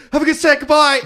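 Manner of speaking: very high, loud mock farewell; hammed-up cheery exit line; retold hasty goodbye. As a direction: shout it like you're fleeing the room. Retold hasty exit from dressing room.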